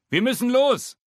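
high, loud hurry-up call; Papa calls to hurry up; calling out urgently. A narrator's reading. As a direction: push everyone out the door. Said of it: "ruft Papa aus dem Flur".

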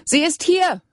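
high, loud call across the house; calling back. A narrator's reading. Rebecca calls back to Siving outside, high and loud. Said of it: "rief Rebecca zurück".

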